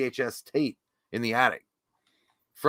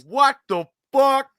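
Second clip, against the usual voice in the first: high, loud, slow stunned cry; mock disbelief; 12 semitones higher, 9 dB louder, slower than usual. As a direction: play your own shock big. Retelling own reaction.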